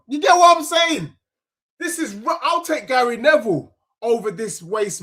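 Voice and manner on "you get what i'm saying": raised, emphatic fan shout. Real, not acted. Football fan ranting at his chat, loud and insistent. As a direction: hammer the question at the chat.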